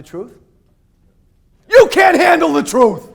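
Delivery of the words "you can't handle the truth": much higher, much louder hammed-up movie yell; famous movie line bellowed for laughs; movie line shout. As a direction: belt out the famous courtroom line. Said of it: "Always wanted to say that".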